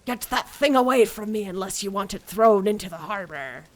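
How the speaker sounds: loud hammed-up pirate accent; horrible pirate accent. Player imitating the game pirate, loud bad pirate accent. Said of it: "like, a horrible accent ... pirate".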